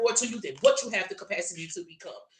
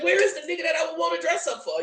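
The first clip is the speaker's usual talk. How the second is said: higher, louder mock whine; mock shouted complaint; 8 semitones higher, 4 dB louder than usual. Mocking single women, a higher, louder whiny shout. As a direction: shout it like a fed-up single woman.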